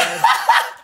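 short high-pitched giggle; high breathy laugh. Real, not acted. Streamer scared to watch, high nervous laugh. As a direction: let out a quick high laugh.

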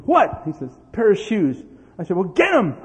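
loud, high short snaps; loud impatient orders; impatient dad. Retold or imitated. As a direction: hurry the kid up.

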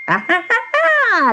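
high, shrieking witch cackle. A narrator's reading. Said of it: "laughed the old woman".

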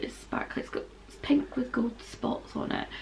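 soft voiceless whisper, voice gone; whispering from lost voice. Real, not acted. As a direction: whisper it, you can barely speak. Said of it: "Apologies for the voice".

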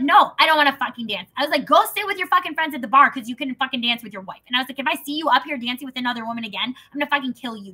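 retold furious reply. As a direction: tear into him.

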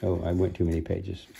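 usual speaking voice

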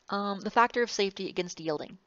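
ordinary talk

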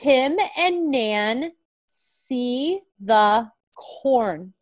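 very slow, clear reading; reading to a toddler. Shown as a demonstration. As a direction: read word by word for a small child. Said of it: "let's read our sentence together".

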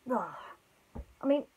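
ordinary delivery, nothing marked

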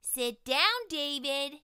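high, loud stern teacher voice; scolding teacher. Acted. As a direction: scold him like a strict teacher. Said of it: "David's teacher always said".

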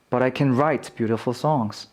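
light CAN, stress moved on to WRITE; unstressed can. Shown as a demonstration. Teacher repeating positive half for learners, unstressed can. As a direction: glide over 'can' onto 'write'. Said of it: "강세가 없으면 긍정일 때가 많고".

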